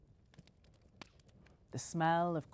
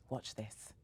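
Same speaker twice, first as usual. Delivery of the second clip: whisper; 4 semitones lower, 10 dB quieter than usual. A narrator's reading. Mum shows a trick.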